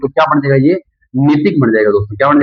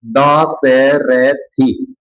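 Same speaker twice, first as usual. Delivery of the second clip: Dasarathi stretched very slowly, each syllable held; drawn out; much slower than usual. Shown as a demonstration. Teacher giving the final word to students, slowly drawn out. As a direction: dictate the answer slowly. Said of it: "तो दे का क्या हो जाएगा?".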